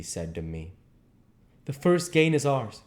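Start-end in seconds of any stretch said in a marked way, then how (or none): 1.7-2.8 high, loud, beaming triumph; triumphant. Acted. Professor telling his friend the first gain is theirs, loud triumph. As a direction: crow the small victory like a chess move. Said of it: "Van Helsing's face almost beamed".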